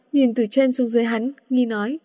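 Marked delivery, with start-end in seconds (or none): none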